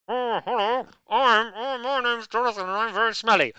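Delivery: high, put-on silly voice for Jonathan; squeaky mock impersonation of the host; mocking impression. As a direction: ham it up as a silly caricature. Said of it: "That's a terrible impression".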